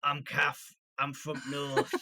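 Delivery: lightly put-on northern English silly voice; mock northern accent. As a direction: tease your co-host with a northern accent. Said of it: "do a silly voice".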